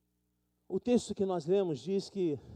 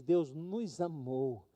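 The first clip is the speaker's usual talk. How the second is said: slow solemn stress; 4 semitones lower, 6 dB quieter, much slower than usual. Acted. Pregação enfática.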